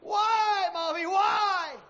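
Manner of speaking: put-on high, slow child's cry; confused children crying to their mother. A narrator's reading.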